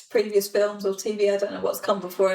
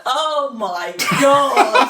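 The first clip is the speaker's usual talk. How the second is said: laughing oh my god; 4 semitones higher, 4 dB louder, much slower than usual. Retold or imitated. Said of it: "I was like".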